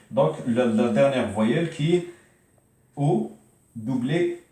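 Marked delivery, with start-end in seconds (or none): none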